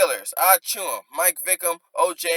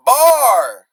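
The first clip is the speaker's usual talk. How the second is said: loud high hype shout; excited shout; 7 semitones higher, 10 dB louder than usual. Real, not acted. Hyping the punchline to the viewers, loud excited shout. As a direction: call out the bar like a crowd.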